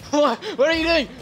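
high loud incredulous yell; shocked shout. Real, not acted. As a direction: shout in disbelief. Teammate stealing boost.

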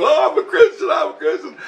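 very high, pleading mock wail; hammed-up high cry of a beaten bully; Loogie Man. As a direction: squeal it out, begging for mercy. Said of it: "I can hear Loogie Man go".

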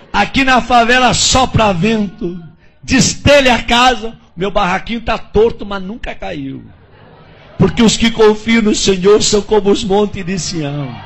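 slow, loud preaching cadence. Acted. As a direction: proclaim it to the congregation. Pastor preaching a psalm.

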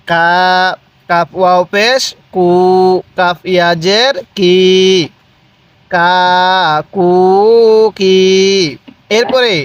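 sing-song spelling drill, name rising then syllable like "লা" held level; teacher's measured recitation with long vowels in "মা, মু, মি"; drawn-out vowels. Shown as a demonstration. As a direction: spell it out, then stretch "গু" or "কি" evenly. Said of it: "এক আলিফ টানের নিয়ম".

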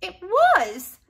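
can't believe he liked it; dismayed. A narrator's reading. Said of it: "asked Leslie in dismay".

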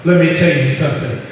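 usual speaking voice

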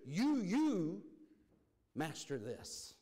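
"you, you" punched high and loud, "master this" slowed and firm; emphatic YOU. Shown as a demonstration. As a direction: slam the doubled "you, you", then land "master this" slowly. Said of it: "it's emphatic when God says".

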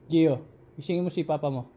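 loud high call through the door; calling to the son to wake his father. Real, not acted.

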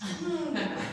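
quiet amused laugh; soft chuckle. Real, not acted. Preacher chuckling softly after the mock whine. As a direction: laugh under the breath.

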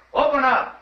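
barked order through a locked door; demanding shout. Acted. Sheriff at the door of the counterfeiter's room, demanding shout.

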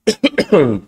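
short dry cough. Real, not acted. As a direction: clear a tickly throat with a cough. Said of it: "desculpa a tosse". (tosse seca)

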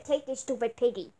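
ordinary talk, nothing marked

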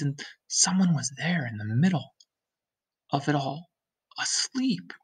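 hushed, rising to a breathy end; whisper. Acted. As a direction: whisper the reveal in awe. Whispered discovery.

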